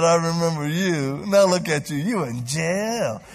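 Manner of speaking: loud, slow mocking taunt; mock gloating. Preacher pretending to taunt jailed kid, loud slow mock jeer. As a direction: drag out you in jail loudly like a jeer. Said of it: "No, I didn't do that".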